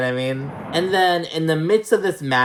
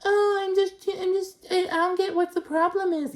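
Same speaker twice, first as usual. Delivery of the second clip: much higher, airy innocent act; mock innocent. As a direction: play dumb in a squeaky fake-innocent voice. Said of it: "Don't be like".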